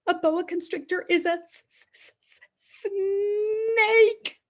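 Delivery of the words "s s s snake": stammering the word out in pretend fright; hammed-up fearful stammer; playful scared stutter for kids; mock scared stutter. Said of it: "I am very scared of snakes".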